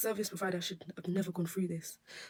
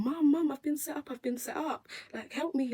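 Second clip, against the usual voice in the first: panicked call; 8 semitones higher, 3 dB louder than usual. Retold or imitated. Panicked phone call to her mum.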